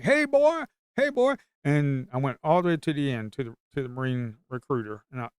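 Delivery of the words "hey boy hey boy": put-on high, loud calling out to a passing kid; recruiters yelling. Recruiters calling out to a boy walking past, loud high holler. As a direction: holler it across the hallway, mock recruiter. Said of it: "All of 'em was yelling at me".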